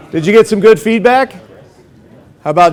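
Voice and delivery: loud, high call to the room. Real, not acted. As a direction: call the class back together. Calling class back after pair work.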